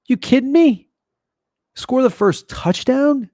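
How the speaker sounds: high, loud disbelief; can't believe that line, half shouting; incredulous. Real, not acted.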